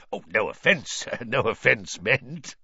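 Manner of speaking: hasty, nervous laugh of apology; smooth it over with a laugh; quickly apologetic. A narrator's reading. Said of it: "Mister Borgin said quickly".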